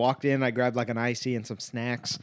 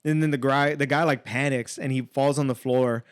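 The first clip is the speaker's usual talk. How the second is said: normal talking voice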